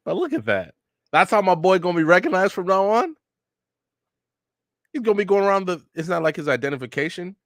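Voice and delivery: high-pitched, amused disbelief; incredulous. Real, not acted. Incredulous reaction to Naruto's photo.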